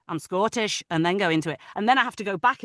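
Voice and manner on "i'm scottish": short Scottish key phrase; put-on Scottish accent. Shown as a demonstration. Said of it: "and then I'll say".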